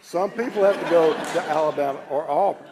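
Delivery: loud playful ribbing; teasing jab. Real, not acted. Louisiana Tech grad teasing rival schools, loud and playful. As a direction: land the jab loudly over the crowd.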